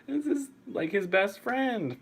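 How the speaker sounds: high, drawn-out plaintive whine; getting emotional. Real, not acted. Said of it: "actually getting emotional talking about it".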